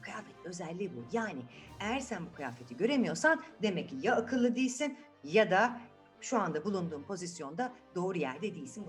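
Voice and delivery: plain reading voice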